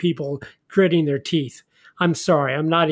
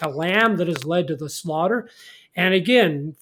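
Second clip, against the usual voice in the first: ordinary delivery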